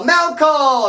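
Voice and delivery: calling out. Retold or imitated. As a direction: shout it down the tier. Guard calling out mail.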